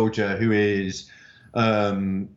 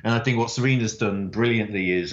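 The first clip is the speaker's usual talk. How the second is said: ordinary talk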